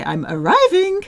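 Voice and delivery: high, loud sing-song call. Shown as a demonstration. Teacher voices the French meaning brightly. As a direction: chirp it out. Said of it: "The French say".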